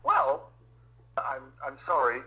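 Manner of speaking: ordinary talk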